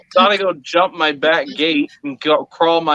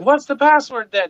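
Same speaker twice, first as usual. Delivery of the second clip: exasperated ask; 9 semitones higher than usual. Retold or imitated. Dad asking daughter through door.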